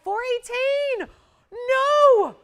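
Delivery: can't believe the number you just said; stunned disbelief. Real, not acted. Host stunned by her own price.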